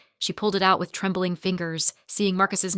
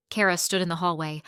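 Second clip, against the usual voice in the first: ordinary delivery, nothing marked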